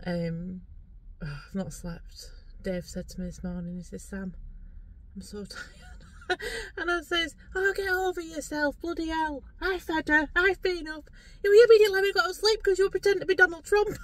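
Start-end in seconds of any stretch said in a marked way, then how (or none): 4.0-6.4 quiet, weary mimicry; imitated tiredness. Retelling Dave's morning complaint, imitated weariness. Retold quote of tired Dave. 7.5-14.0 high, exasperated comeback building louder; mock exasperation. Retelling her sleepless reply to Dave, mock exasperation.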